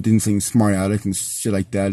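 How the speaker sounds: usual speaking voice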